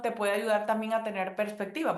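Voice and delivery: ordinary talk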